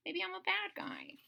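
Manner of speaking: guilty whisper. Acted. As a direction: whisper it like a dragon feeling bad. Dragon doubting himself, quietly.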